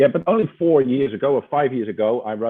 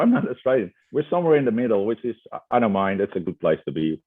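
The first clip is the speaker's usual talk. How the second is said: ordinary talk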